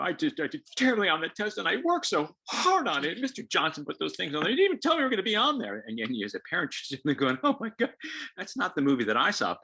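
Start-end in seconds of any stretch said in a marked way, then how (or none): 0.0-5.8 put-on high, loud whiny kid complaint; upset kid. Kid home from school whining about an unfair test. 7.4-9.6 loud, high incredulous scoff; exasperated parent. Retold or imitated. Parent reacting to the kid's excuses with loud disbelief.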